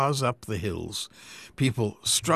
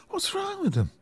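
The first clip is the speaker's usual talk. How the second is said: curious question; 3 dB quieter than usual. Acted. Helen voiced asking.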